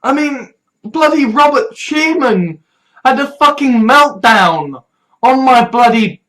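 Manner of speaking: ordinary delivery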